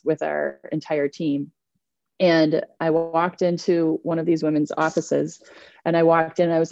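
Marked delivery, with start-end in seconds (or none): none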